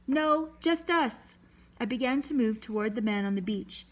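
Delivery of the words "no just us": high, slow, drawn-out call. A narrator's reading. Boy to the boat, calling out his answer. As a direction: call back slowly so it carries. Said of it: "I called out".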